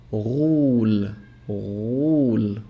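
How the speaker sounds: غول slow, the gh gargled far back; Arabic ghain. Shown as a demonstration. Teacher repeating غول slowly, gargled ghain. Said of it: "This is the sound of the ghain".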